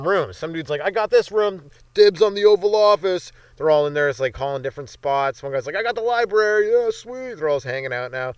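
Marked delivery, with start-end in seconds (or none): 0.8-3.3 louder, higher-pitched rowdy shout of dibs; excited claiming. Acted. Rioter calling dibs on rooms inside the building, excited shout. 5.8-7.3 high, pleased call of dibs; excited claiming. Acted. Rioter grabbing the library for himself, high and excited.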